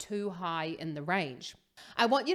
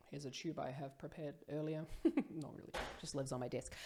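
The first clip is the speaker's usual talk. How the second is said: quiet aside; 5 semitones lower, 11 dB quieter than usual. Real, not acted.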